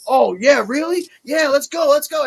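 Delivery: rising eager taunt, up high; imitating Kelly. Kelly accepting the challenge, high excited mock taunt. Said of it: "she's like".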